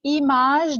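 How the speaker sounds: "إيماج" opening on a clear French ee; teacher's model of i in "إيماج"; initial i sound. Shown as a demonstration. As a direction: say "إيماج" as ee-mazh. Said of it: "دي إي".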